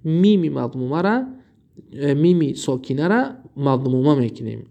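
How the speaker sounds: usual speaking voice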